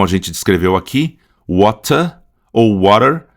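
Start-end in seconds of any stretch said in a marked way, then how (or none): 1.5-2.2 "water" British, clear T, no final R; slow British "water" with open ending; British English accent. Shown as a demonstration. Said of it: "Em inglês britânico". 2.8-3.3 "water" American, tapped T and R ending; slow American "water" with a curled final R; American English accent. Shown as a demonstration. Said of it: "em inglês americano".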